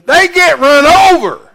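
loud, high preacher's shout; shouted warning. Real, not acted. Preacher warning about playing in the traffic, a forceful shout. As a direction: belt out the blunt consequence.

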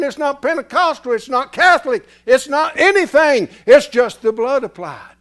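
loud, high preaching cadence; preaching at full force. Acted. Preacher shouting the gospel to the congregation.

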